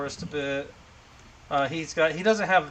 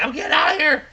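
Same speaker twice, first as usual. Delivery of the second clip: high, loud laughing cry of escape; mock panic; 12 semitones higher, 5 dB louder than usual. Voicing scaredy-cat Aram facing away, mock panicked yell. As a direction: pitch it up high, half laughing.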